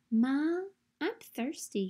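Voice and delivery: usual speaking voice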